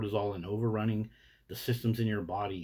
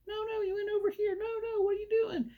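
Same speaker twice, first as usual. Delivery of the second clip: squealing falsetto mock nagging; bickering neighbors. Neighbors squabbling over their chihuahuas, mock shrieky bickering. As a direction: scold in a silly, extremely high voice. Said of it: "they're bickering amongst each other".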